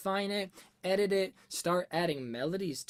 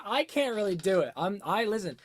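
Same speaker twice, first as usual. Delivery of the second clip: usual speaking voice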